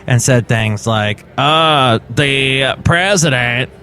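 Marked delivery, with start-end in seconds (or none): 1.4-3.7 slow, put-on Southern drawl, lightly done; mock Rubio's forced Southern accent; fake Southern accent. Host mocking Rubio's false Southern accent, slow. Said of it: "trying to affect a Southern accent".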